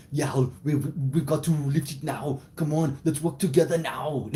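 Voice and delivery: loud, high, straining action-hero shout; Arnold Schwarzenegger. Shown as a demonstration. Boss rallying employees to lift, strained action-hero impression. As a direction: bellow in a thick Austrian accent, heaving something heavy. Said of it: "I do a great Arnold Schwarzenegger impression".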